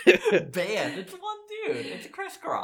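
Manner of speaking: very high, laughing disbelief; surprised. Real, not acted. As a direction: squeak out the shock. Shocked correction about Crisscross.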